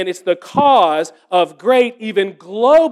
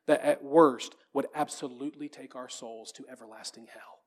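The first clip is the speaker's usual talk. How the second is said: hushed earnestness; 6 semitones lower, 12 dB quieter than usual. Real, not acted. Preacher drops to a hushed earnest plea.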